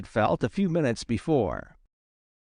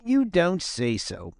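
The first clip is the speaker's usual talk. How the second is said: higher, lightly feigned surprise; mock surprise; 5 semitones higher than usual. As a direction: act surprised, teasing. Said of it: "pretending to be very much surprised".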